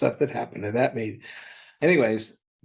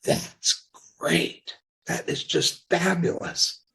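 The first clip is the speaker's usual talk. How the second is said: awed approval; 5 semitones higher, slower than usual. Retold or imitated. Awed reaction to the final title.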